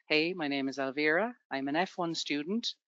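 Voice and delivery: normal talking voice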